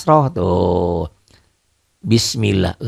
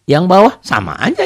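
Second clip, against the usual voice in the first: usual speaking voice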